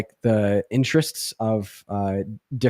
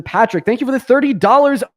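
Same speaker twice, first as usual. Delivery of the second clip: hyped thanks; 11 semitones higher, 10 dB louder than usual. Real, not acted.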